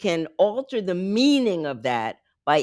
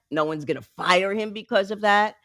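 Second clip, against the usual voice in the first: ordinary talk, nothing marked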